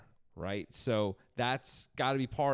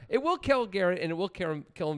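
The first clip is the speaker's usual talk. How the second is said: high, louder, leaning on will; gleeful emphasis; 6 semitones higher, 4 dB louder than usual. Real, not acted. Co-host joking about the AI, gleefully emphatic. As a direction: relish the joke about the AI.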